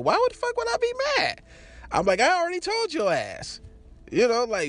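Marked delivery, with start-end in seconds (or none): 0.0-1.4 high, fast retold protest; incredulous denial. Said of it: "she thought I was mad, but I was like". 2.2-3.6 high-pitched retold comeback; insistent retort. Retold denial of being mad.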